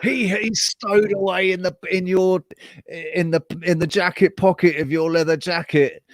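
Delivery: high, squeaky cartoon bug voice, indignant; leader cockroach voice. Leader cockroach protests to the roommate, high cartoon voice. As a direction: play an outraged little cartoon creature. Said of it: "the leader cockroach turns around and says".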